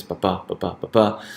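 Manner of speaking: pa-pa, pa-pa, pa-pa as weak-strong beats; alternating stress pattern. Shown as a demonstration. Teacher sounding out poetry's rhythm, alternating stress on pa-pa, pa-pa, pa-pa. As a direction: beat out pa-PA with the second syllable stressed each time. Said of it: "the alternating stress pattern".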